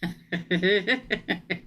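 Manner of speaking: long stuttering laugh; guilty laugh. Real, not acted. Reader laughs at his coming anger, guilty laughter. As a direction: laugh though you shouldn't. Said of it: "I shouldn't laugh".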